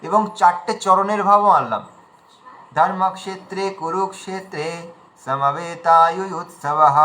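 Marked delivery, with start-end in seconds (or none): 2.8-6.9 very slow chant with a clear pause after কুরুক্ষেত্রে; slow, deliberate Sanskrit recitation; pause mid-line. Shown as a demonstration. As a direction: split the line at কুরুক্ষেত্রে, then draw out সমবেতায়ুৎসবः. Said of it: "মাঝখানে যে থেমেছিলাম কুরুক্ষেত্রে বলে".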